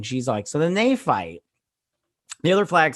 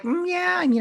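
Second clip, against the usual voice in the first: mock hesitant agreement, pitched way up; doubtful reaction. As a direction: squeak out a reluctant half-agreement. Voicing own doubtful reaction to monologue.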